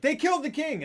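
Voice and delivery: high, loud alarmed shout; alarmed cry. Retold or imitated. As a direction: cry out the news in shock. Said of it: "they're like".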